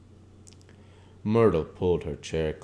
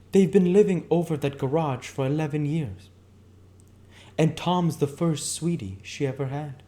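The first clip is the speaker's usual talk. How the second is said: higher, louder woman's voice sharing a secret; Catherine, gossipy confidante; 10 semitones higher, 6 dB louder than usual. Acted. Catherine telling Nick about her sister's marriage, higher gossipy voice. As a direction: lean in and dish the dirt, pitched up.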